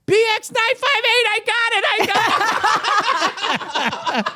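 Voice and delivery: high shouted plate number, hammed up; mock yell. Woman yelling plate number after fleeing car. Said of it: "I hear her yell out".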